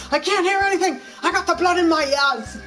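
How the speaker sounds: loud, high mock freakout with a laugh; hammed-up rage, half laughing; imitating a freakout. Imitating Richard losing it over stolen candy, mock loud freakout. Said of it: "he's like".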